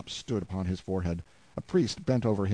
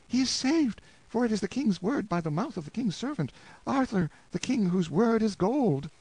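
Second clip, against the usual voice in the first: joyful wife; 13 semitones higher than usual. Acted. Wife rejoicing that her husband is saved, high joyful cry. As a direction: cry out in relief.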